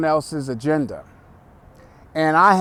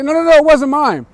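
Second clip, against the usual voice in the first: much higher and louder, rushed; frantic denial; 11 semitones higher, 8 dB louder, faster than usual. Retold or imitated. Prank victim denying to the caller in frantic panic. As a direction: panic rising to a shriek. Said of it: "And the person's like".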